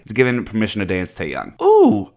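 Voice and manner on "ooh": high squeal of delight; delighted ooh. Real, not acted. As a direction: squeal as the photo appears. Sudden delight at picture.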